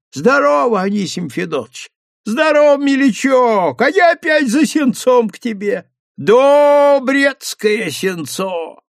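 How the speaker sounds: выше, распевно, по-деревенски; сыграть простака-мужика; простоватый мужик. A narrator's reading. Пузиков играет простоватого мужика. (переодетый Пузиков изображает мужика)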